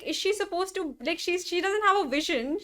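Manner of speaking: ordinary talk, nothing marked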